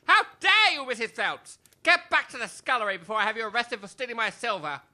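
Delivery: high, loud indignant outburst; outraged. Acted. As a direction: explode at the servant's cheek. Major furious at servant.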